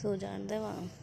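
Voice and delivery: low, very soft scolding; hushed warning. Real, not acted. Mother telling child to let the sleeper be, hushed warning. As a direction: threaten under your voice so the sleeper stays asleep.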